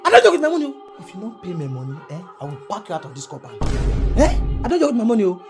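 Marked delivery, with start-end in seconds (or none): 0.1-1.0 loud, raised warning about rent; landlord's warning. Acted. Landlord scolding tenant over unpaid rent, loud and firm. 4.6-5.4 loud, raised warning about rent; landlord's warning. Acted. Landlord scolding tenant over unpaid rent, loud and firm.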